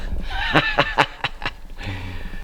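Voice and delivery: soft staccato laugh after a fright; startled laugh. Real, not acted. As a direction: laugh off a creepy surprise. Spider found on his hand.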